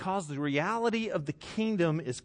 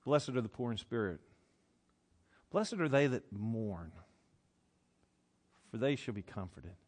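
much softer, hushed and lower blessing; tender Jesus; 7 semitones lower, 8 dB quieter than usual. Retold or imitated. Jesus blessing the widow before the crowd, soft and tender. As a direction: speak it gently, like comfort to a widow. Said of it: "and he says".

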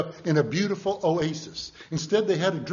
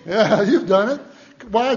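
usual speaking voice

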